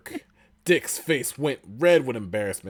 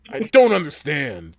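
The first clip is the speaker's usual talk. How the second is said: angry; 7 semitones higher, 12 dB louder, slower than usual. Acted. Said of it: "Dick said angrily".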